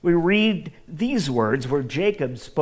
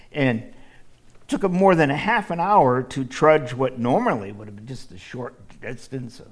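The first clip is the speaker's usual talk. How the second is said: usual speaking voice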